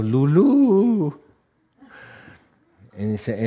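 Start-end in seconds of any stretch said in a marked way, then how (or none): 0.0-1.2 high, drawn-out "Lulú" wail; mock madman calling out, pitched high and louder; asylum patient's cry. Retold patient rolling in a padded cell, crying out. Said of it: "rodando y diciendo".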